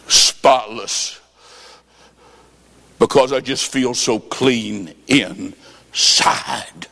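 low, slow, choked-up words; woman crying as she tells of feeling clean; tearful testimony. Retold or imitated. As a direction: let the tears slow it right down. Woman crying, deeply moved.

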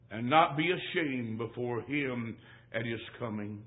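low, hushed scripture reading; solemn preaching. Real, not acted. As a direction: drop low and soft for a grave warning. Drops voice for solemn scripture ending.

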